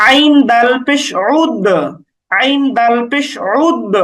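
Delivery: slow spelling, then "উদ" with bounced d; bouncing qalqalah. Shown as a demonstration. Teacher spells ain and dal for learners, slow bouncing qalqalah. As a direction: release the final d of "উদ" with a small echo. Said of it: "এটা হচ্ছে কলকলা".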